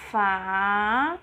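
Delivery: "Fada" drawn out as FAA, long pause, DA; syllable by syllable. Shown as a demonstration. Teacher showing two little pieces, "Fada" slowly split. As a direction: hold each syllable of "Fada" on its own. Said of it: "São dois pedacinhos".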